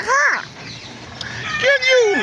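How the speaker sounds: high, light mock attendant voice; imitating attendant. Attendant asking him at the car window, high mock voice. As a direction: pitch it way up like the small attendant.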